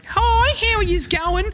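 high, hollered broad Australian greeting; full Aussie accent. Retold or imitated. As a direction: overdo the Aussie hello. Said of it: "couldn't be more Aussie".

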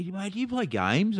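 put-on high, eager young-guy voice; imitating the young guy. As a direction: pitch up, keen teenager. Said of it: "he started".

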